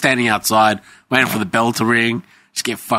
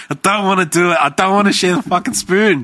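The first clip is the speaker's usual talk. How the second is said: mock whiny protest; 10 semitones higher than usual. Retold whiny protest to mum.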